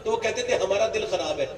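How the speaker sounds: impassioned shouted sermon; fiery preaching. Acted. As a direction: preach it out to the crowd. Preacher at full force.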